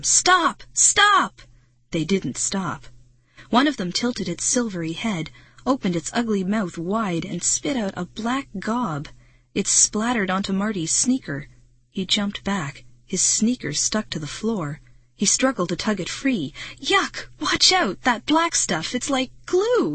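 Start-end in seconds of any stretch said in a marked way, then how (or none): 0.0-1.4 high, loud, separate commands; shouting. A narrator's reading. Marty up at the giant insects, shouting. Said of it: "shouted up at them". 16.8-19.9 high-pitched alarmed warning; crying out. A narrator's reading. Marty with his sneaker stuck in goo, crying out. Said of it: "he cried".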